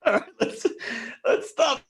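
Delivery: high-pitched laughing protest. Real, not acted. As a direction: squeal through laughter. Laughing high squeal stopping scene.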